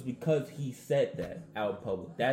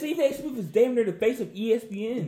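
much higher, louder, insistent; excited emphasis; 10 semitones higher, 5 dB louder than usual. Real, not acted. Cohost insisting Stephen A is ESPN's face, excited. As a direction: hammer home the point. Arguing loudly about ESPN star.

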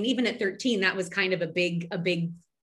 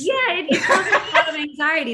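laughing; 8 semitones higher, 8 dB louder than usual. Real, not acted. Laughing reply to host.

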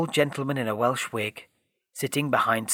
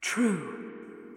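ghost voice; 8 semitones higher, 7 dB quieter than usual. A narrator's reading. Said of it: "said the ghost".

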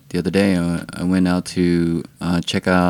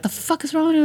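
much higher, scornful quip; mock outrage, pitched way up; faster than usual. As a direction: squeal it out in a put-on voice. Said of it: "He's like".

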